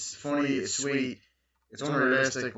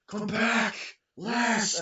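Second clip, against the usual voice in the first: calling out; 11 semitones higher, much slower than usual. Retold or imitated. Dad calling after son.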